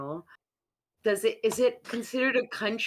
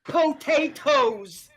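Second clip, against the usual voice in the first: triumphant reveal; 5 semitones higher, 6 dB louder, much slower than usual. Pretend.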